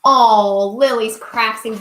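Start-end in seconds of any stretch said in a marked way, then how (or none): none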